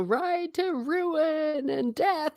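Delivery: high, very slow mock rallying cry; hammed-up kingly call to arms; Theoden battle cry. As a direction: intone it like a king calling the charge. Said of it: "You know".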